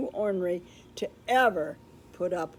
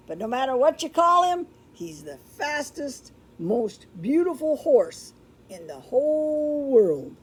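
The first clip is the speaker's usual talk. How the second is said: higher, louder eager cowhand; Chuck's voice; 4 semitones higher, 5 dB louder than usual. Acted. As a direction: sell the tale like an excited cowhand.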